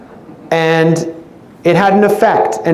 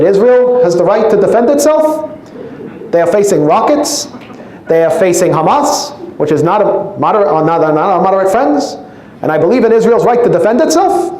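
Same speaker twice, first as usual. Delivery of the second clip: higher, raised Bernie voice; Bernie Sanders impression; 5 semitones higher than usual. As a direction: mimic Bernie lecturing the crowd. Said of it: "I would do a Bernie Sanders impersonation".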